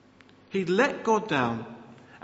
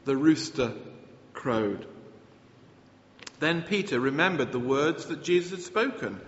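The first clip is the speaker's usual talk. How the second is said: usual speaking voice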